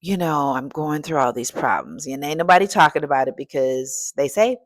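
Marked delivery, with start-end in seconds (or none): 0.0-2.1 slow, drained whisper of a worn-out employee; slow, much softer, whispered zombie moan; tired zombie worker. Retold or imitated. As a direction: drag the words out like a corporate zombie. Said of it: "walking around like zombies".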